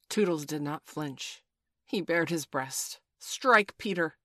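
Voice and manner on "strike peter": loud, resolute command; firmly. A narrator's reading. Toodles baring his breast to Peter, firmly. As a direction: punch the first word hard. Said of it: "he said firmly".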